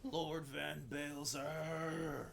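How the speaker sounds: slow, loud, high ringing cry; dramatic drawn-out shout. Acted. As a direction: call the lord's name out long and loud.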